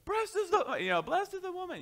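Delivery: high mock-gushing "blessed is the woman."; mock quarterback gushing. Preacher imagines a quarterback running off the field, high mock gushing. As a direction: squeal it like a jock caught off guard. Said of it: "can you imagine a football quarterback".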